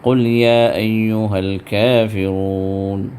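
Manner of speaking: يا أيها held for two counts; two-count madd. Shown as a demonstration. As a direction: stretch يا for two beats. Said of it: "اسے دو حرکت کھینچ کر".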